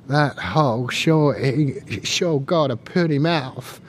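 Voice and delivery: slow, drawn-out Southern drawl; Southern accent. Retold or imitated. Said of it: "you're gonna have to endure my southern accent".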